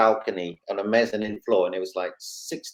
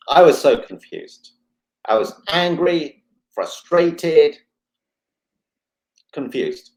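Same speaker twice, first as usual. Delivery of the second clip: louder and higher, a real flare of frustration; retold anger; 4 semitones higher, 8 dB louder, slower than usual. Telling the doctor how angry he was, loud and higher. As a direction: let the anger from back then burst out again.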